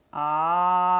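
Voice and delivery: A as one long, low held sound; drawn-out vowel. Shown as a demonstration. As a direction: hold A steadily. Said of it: "si j'entends".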